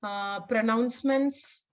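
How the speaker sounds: normal talking voice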